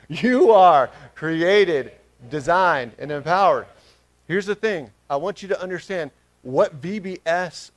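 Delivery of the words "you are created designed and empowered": very loud, drawn-out proclamation; booming group declaration. Real, not acted. Preacher has the church tell their neighbor, loud slow declaration. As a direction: proclaim it slowly, each word punched. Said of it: "Turn to your neighbor".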